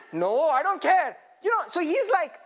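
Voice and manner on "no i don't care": defiant. Acted. David brushes the objectors off.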